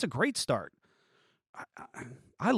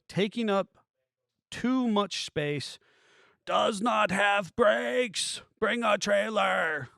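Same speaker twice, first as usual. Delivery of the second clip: high, slow, mocking read of the seller's ad; mock listing voice; 6 semitones higher, slower than usual. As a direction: drawl it out like a lazy seller's listing.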